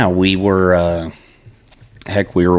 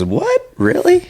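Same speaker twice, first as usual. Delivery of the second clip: stunned surprise; slower than usual. Retold or imitated. Stranger learning the owner stands right behind him, shocked high voice. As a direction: voice leaps far higher in startled disbelief. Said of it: "And he goes".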